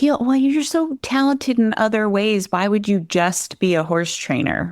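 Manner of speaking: high, puzzled disapproval; doubting outsider. Retold or imitated. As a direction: imitate someone who doesn't get it, lifted pitch. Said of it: "other people too that were like".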